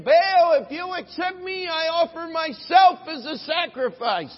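high, loud pleading; high, loud, overdone plea to Baal; hammed-up frantic prayer. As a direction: throw yourself at the idol. Prophet offering himself.

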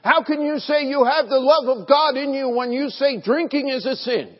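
mimicking a drunk's high, loud accusing tirade; belligerent drunk. Preacher imitating the drunk who cursed at him, loud and high. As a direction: throw the question back hard, like the drunk did. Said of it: "he got rather belligerent and began cursing".

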